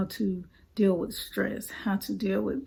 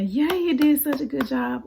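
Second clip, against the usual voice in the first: higher, brighter mock cheer for the child; cheering praise; 6 semitones higher, 4 dB louder than usual. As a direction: cheer them on like a proud mom. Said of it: "we wanna make sure we're encouraging".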